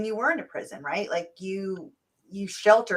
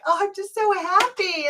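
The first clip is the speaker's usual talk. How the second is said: imitated happy crying, much higher pitch; happy tearful; 11 semitones higher than usual. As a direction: reassure the kids in a high, overjoyed voice. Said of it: "These are happy tears".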